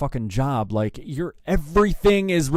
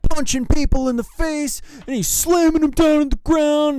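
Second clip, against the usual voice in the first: high, loud shouted mock account; 13 semitones higher, 5 dB louder than usual. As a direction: mimic the singer's worked-up complaint at full volume.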